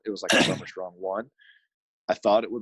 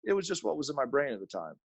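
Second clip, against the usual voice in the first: put-on light Canadian accent, higher pitch; casual friend voice with Canadian accent; Derek Witzke impression; 9 semitones higher than usual. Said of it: "He was a very good Derek Witzke".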